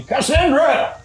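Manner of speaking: calls up the stairs to his wife; father hollering that their daughter is home; yelling upstairs. Acted. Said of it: "he yells again".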